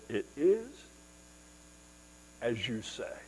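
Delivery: very soft, unforceful reply; simple, understated answer. Acted. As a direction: say it simply and quietly, with a pause midway. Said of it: "He simply said".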